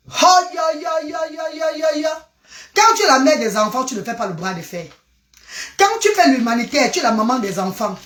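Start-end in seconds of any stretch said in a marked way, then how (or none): 0.1-2.1 pained aïe cries. Real, not acted. Crying out to viewers mid-live, high yelps.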